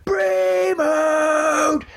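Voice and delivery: playful imitated war cry; mock battle cry. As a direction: yell it like a wrestler fired up in the ring. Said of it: "keeps on shouting out Brie mode".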